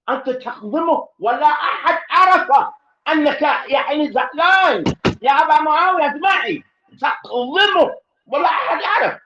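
high, loud, emphatic; loud high sermon emphasis; preaching at full force. Shown as a demonstration. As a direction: drive the point home loudly. Preacher stressing hidden anger.

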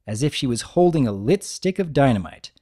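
usual speaking voice

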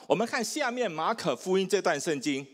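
ordinary talk, nothing marked

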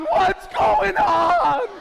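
slow, loud, drawn-out bewildered cry; baffled townsfolk. Retold or imitated. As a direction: stretch it out like a confused crowd shouting. Jericho people baffled.

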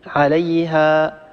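عليها with the final alif stretched long and fading out; long fading madd. Shown as a demonstration. Tajweed teacher showing how to stop on a long vowel, fading. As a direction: hold the last vowel of عليها and let it die away. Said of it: "هنا مد بالألف وقفنا عليه".

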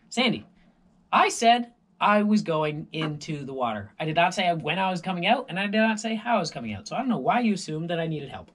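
loud, fast indignant rant; huffy and defensive, rushing. Retold or imitated. Retold indignant swimmer.

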